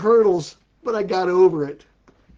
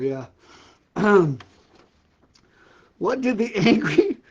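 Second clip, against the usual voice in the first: normal talking voice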